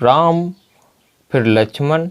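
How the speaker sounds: a clear comma break after "Ram"; pause between names. Shown as a demonstration. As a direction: stop after "Ram" before going on to "फिर Lakshman". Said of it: "यानी बात को रोक". ("राम" के बाद रुककर)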